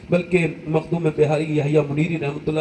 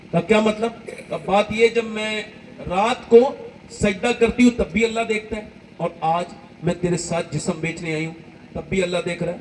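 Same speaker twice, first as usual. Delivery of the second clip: higher, emotional storytelling sermon; impassioned preaching; 5 semitones higher than usual. Acted. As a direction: preach the story with rising passion. Impassioned story preaching, high pitch.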